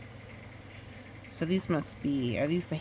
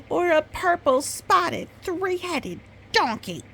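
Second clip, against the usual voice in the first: higher and louder, delighting in the silly image; playful excitement; 12 semitones higher, 6 dB louder than usual. A narrator's reading. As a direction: build it up like a joke for the kid. Silly climax of a list.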